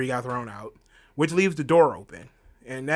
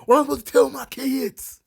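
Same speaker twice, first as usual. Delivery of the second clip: high, loud, fast hammed-up despair; mock despairing plea; 11 semitones higher, 6 dB louder than usual. Roman agonizing over his kids, high hammed-up despair. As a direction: wail it like a tragic hero.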